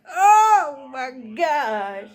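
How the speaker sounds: shocked gasp. Retold or imitated. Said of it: "I said".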